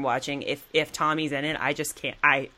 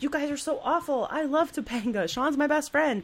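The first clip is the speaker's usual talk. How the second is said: high, whiny mock teenager; mock a bratty kid yelling at his parents; high-pitched bratty teen whine; whiny teen Corey; 10 semitones higher than usual. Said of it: "Corey loves to yell at his parents and be like".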